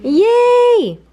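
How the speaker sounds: very high, loud squeal of joy; excited yay. Real, not acted. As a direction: squeal with delight. Excited at delivery.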